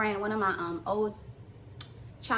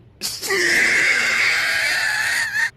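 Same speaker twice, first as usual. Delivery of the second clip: long high loud shriek of laughter; 11 dB louder than usual. Real, not acted. Realising she got scammed, a shrieking laugh. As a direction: let out a huge screaming laugh.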